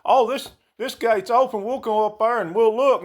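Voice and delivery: teasing his wife with a made-up grandma; imitating nosy grandma. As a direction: put on a curious old lady voice. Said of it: "and say".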